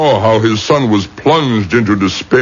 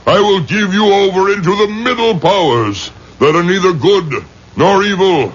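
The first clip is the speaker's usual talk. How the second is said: thundering ritual command; 5 semitones higher than usual. Acted. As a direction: call down the powers like a final sentence. Rabbi summons the middle powers.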